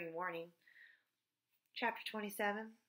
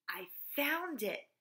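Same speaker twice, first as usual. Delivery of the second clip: higher, drawn-out cry of discovery; excited exclamation; 6 semitones higher, slower than usual. A narrator's reading. Mauricia announces her find to Joy, excited exclamation. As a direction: call it out slowly, thrilled at the find. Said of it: "Mauricia exclaimed".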